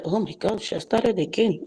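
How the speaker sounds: soft, low exasperated aside; imitated colleagues' aside. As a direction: drop your voice as colleagues murmuring about someone. Colleagues' aside about her, retold.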